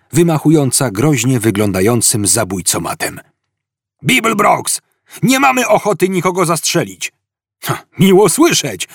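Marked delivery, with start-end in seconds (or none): 4.0-7.1 high-pitched police shout; calling out. A narrator's reading. Police officer calling out across hall. 7.6-8.9 high, punchy roared retort. A narrator's reading. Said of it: "ryknął Zafod".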